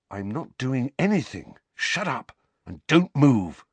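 sharp, urgent insistence; urgent order. A narrator's reading. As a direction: order him urgently to keep still. Harry urgently orders frightened Dudley.

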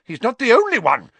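high, loud, flustered outburst; Fudge puffing. A narrator's reading. Minister complaining of his own disappointment, puffing. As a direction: puff it out, much put out. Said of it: "puffed Fudge".

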